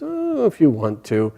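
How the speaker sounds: high, slow, drawn-out "oh"; indifferent monkey. Acted. Monkey answering Bhima's threat, indifferent and lazy. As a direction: lazily let him try, stretching it out. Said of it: "the monkey says".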